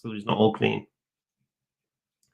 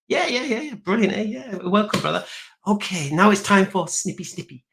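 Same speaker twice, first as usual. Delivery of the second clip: much higher, softer mock-sly questioning; 7 semitones higher, 3 dB quieter than usual. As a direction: creep up on him with a high, hushed question.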